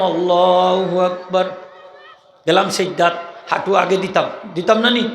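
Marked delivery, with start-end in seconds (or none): 0.0-1.4 slow, drawn-out আল্লাহু আকবার; slow takbir. Shown as a demonstration. Imam demonstrating the prayer's takbir slowly. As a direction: stretch আল্লাহু আকবার out calmly. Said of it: "এরপরে কইতাম".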